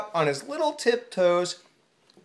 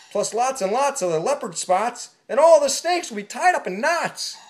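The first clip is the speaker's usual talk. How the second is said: yelling; 6 semitones higher, 4 dB louder than usual. Acted. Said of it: "The zebra yelled".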